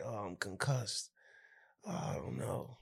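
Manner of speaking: slow, weak mock-dazed mumble fading to a breath. Cook just hit by the ceiling, hamming up being dazed. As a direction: play woozy after a knock on the head. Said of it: "I'm like".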